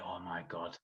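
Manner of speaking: a barely voiced gasp of nerves before the walk; nervous hush. Nervous wait behind the stage.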